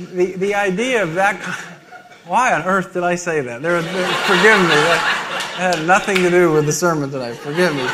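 real embarrassed laughing fit while apologizing; flustered laughter. Real, not acted. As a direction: try to talk through helpless laughter. Flustered laughter at own slip.

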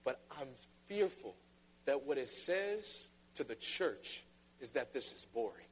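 ordinary talk, nothing marked

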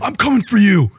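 booming, high trash-talk challenge; wrestling promo. Pretend. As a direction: hype it like a wrestler calling out a rival. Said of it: "just make it like a wrestling promo".

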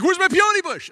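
high, loud mock demand; upset wife. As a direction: imitate a distressed wife calling it out. Retold upset wife demands bush.

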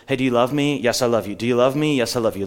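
quick, offhand question and answer; light, hurried singsong back-and-forth; casual run-through. Shown as a demonstration. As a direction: rattle it off as a rote exchange. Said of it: "what we hear is just".

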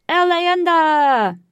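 slow, high, loud Spanish chant; fake Spanish accent. A narrator's reading. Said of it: "in a fake Spanish accent".

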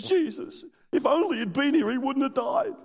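high, pleading lament; pleading Mary. Retold or imitated. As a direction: plead through grief.

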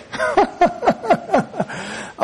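a burst of high delighted laughter; high joyful laugh. Real, not acted. Preacher laughs with joy before the good news. Joyful outburst mid-sermon.

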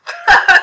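high bursting laugh; real laugh mid-retelling; sudden laugh. Real, not acted. Laughs quoting her brother.

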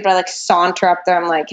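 ordinary talk, nothing marked

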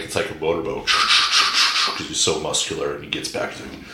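usual speaking voice

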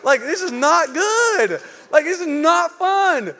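high, slow, half-laughing whine; laughing distress. Retold or imitated. Pastor joking he is on fire, high half-laughing near-crying whine. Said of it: "And I'm like".

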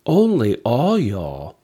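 ONLY leaned on, slow and drawn out; emphatic slow Only all y'all; stress on ONLY. Shown as a demonstration. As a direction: slowly hit Only, then all y'all. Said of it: "stresses collective you".

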